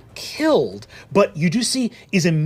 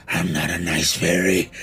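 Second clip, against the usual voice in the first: very low menacing sigh and line; a deep, threatening little redcap; menacing fairy; 12 semitones lower than usual. Acted. Redcap to the party, low menacing growl. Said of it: "Looks at you and goes".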